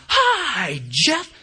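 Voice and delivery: loud, high imitation of a shouting crowd; top-of-lungs group greeting. Imitating three hundred people greeting a speaker, top-of-lungs shout. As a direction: holler it high like a whole roomful. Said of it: "three hundred people at the top of their lungs".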